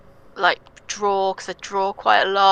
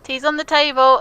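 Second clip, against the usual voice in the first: mum voice; 7 semitones higher, 5 dB louder than usual. Retold or imitated. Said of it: "and she'd be like".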